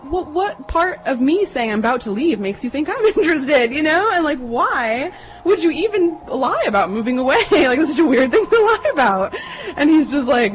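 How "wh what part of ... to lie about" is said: high-pitched, half-laughing disbelief; incredulous retort. Retold or imitated. Woman to date who rejected her, laughing incredulity. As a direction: challenge him in a high, baffled voice.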